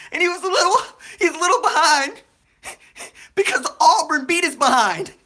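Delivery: high, loud words broken by laughter; crying with laughter as you explain; laughing. Real, not acted. Cracking up mid-story.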